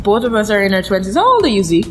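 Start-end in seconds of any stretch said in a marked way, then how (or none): none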